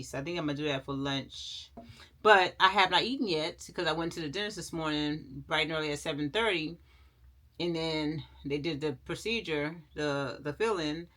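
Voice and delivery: ordinary talk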